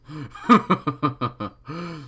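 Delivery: creepy chuckle. Acted. Villain laughs.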